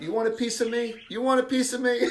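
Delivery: high-pitched playful taunt; cocky, joking dare; roughhousing challenge. Acted. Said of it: "like, a little roughhousing".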